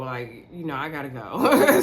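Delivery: low voice breaking into laughter; amused retelling. Retold or imitated. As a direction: imitate the exit line while starting to laugh. Laughs while retelling Andre's exit.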